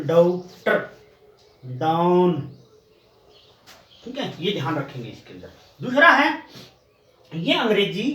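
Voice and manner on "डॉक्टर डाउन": डॉक्टर and डाउन with a drawn-out vowel between daa and do; lower, careful demo of डॉक्टर and डाउन. Shown as a demonstration. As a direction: model डॉक्टर, then डाउन, slowly, each on its own. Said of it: "न तो डा बोलेंगे".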